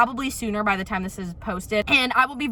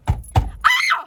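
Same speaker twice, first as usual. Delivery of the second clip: short shrill squeal, much higher; sudden high squeal; 5 dB louder than usual. Real, not acted. Ranting about her dad, bursting into a high squeal. Sudden outburst mid rant.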